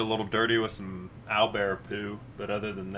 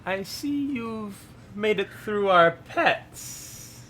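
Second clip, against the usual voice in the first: slow, high, eerie forest hag; creepy hag voice; 9 semitones higher, slower than usual. Acted. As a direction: purr a veiled threat as a witch. DM voices hag greeting party.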